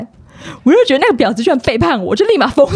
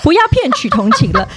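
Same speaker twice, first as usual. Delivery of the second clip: ordinary delivery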